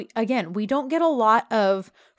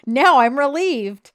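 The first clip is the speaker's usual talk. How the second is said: drawn-out, louder sarcastic relief; mock sarcastic; 5 semitones higher, 7 dB louder, slower than usual. [name] pretends relief at Yeet's presence, sarcastic. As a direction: lean slowly and loudly on fake relief. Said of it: "[name] is so sarcastic".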